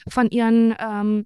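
ordinary delivery

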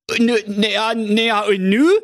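very high, flustered comic king; a panicking king shouting orders; king losing his head over his missing daughter, high and agitated; flustered King Pilsner. Acted. King stammers.

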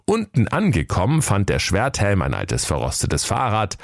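ordinary talk, nothing marked

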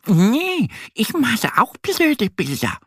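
very high-pitched duck character; little duck disagreeing. A narrator's reading. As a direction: squeak a friendly denial. Duck answers, shaking her head.

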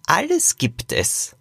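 put-on slow, mysterious old great-grandfather. A narrator's reading. Great-grandfather answers whether the cave exists, slow and mysterious. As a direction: draw it out mysteriously, starting high. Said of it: "antwortete der Urgroßvater geheimnisvoll".

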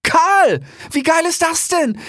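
very high, loud youthful cry of delight; young fox thrilled to see his friend, high and loud; excited young fox. Acted. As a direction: squeal with joy at seeing a friend. Young fox Rix overjoyed.

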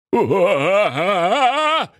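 high, wavering cry of fear; frightened wail. A narrator's reading. Old miser carried over the rooftops, frightened wail. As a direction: wail in acted terror. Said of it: "Sprach der Knöterich mit ängstlicher Stimme".

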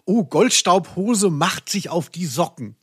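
usual speaking voice

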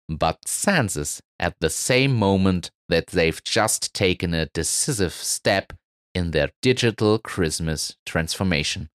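ordinary delivery

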